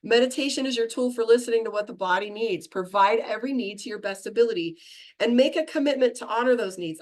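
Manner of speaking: normal talking voice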